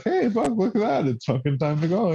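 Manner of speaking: sudden high excited shout of discovery; excited call. Retold or imitated. Friend telling the story, suddenly noticing the road reopening: excited high call. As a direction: yelp it out high, thrilled at the news.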